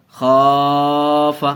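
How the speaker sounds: خاف slow, aa held long; teacher repeats خاف with the long vowel; drawn-out vowel. Shown as a demonstration. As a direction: drag out خا then clip the ف. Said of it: "خا کو کھینچ کے پڑھا".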